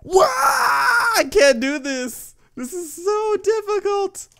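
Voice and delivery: loud, high-pitched fake bawling; mock wailing. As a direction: wail like a baby over a hard game. Mock tantrum over hard level.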